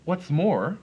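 high, indignant snap; storybook character with his dander up; snapped. A narrator's reading. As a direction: break in crossly, pitched well up. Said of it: "snapped the Lorax, his dander was up".

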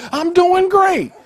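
very high, drawn-out shout; loud, soaring sermon cry; exuberant declaration. Shown as a demonstration. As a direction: proclaim it at full voice, slowly. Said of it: "but just say".

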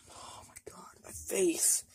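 whispered in real pain. Real, not acted. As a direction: breathe it out, face on fire. Face burning from pepper.

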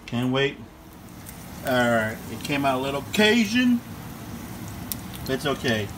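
usual speaking voice

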